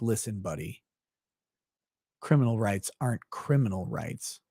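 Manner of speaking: low and soft, like a quiet correction; hushed lecture. Retold or imitated. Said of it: "And I was like".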